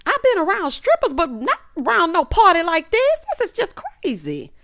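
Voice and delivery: hammed-up indignant mom voice; imitating Mama Joyce. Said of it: "she was like".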